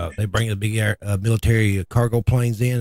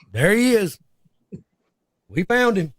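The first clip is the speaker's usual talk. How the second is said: high, triumphant call; jokey cheer of a rescuer finding a body; mock excited discovery; 10 semitones higher, 3 dB louder than usual. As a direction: announce the find like a prize.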